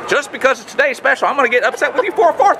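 high-pitched yelling pitchman; mock a TV salesman yelling at his wife; Billy Mays. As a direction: strident infomercial shout, played for laughs. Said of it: "That's what that guy sounds like".

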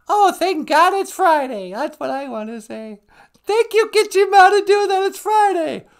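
very high, loud puppet-like voice; high-pitched comic exclaiming; squeaky sidekick. Pretend. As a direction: squeal your thanks far above your own voice.

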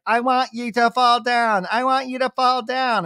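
put-on high, insistent little-kid voice; bratty child voice. Summer Moon pushing her mom off the beanbag, high kid voice. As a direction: chant it like a stubborn toddler. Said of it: "she's like".

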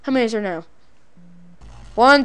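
ordinary talk, nothing marked

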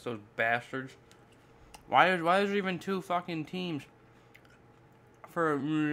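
eating an apple mid-sentence; talking while chewing. Real, not acted. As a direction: mouth partly full of apple. Seen 1: Bites apple at -1.0, holds bitten apple while talking after.

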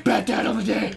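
rasping third-person hero; Bat Dad. Acted. Friend hypes himself mid-match, Bat Dad voice. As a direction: announce yourself in a hero rasp. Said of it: "I was doing Bat Dad".

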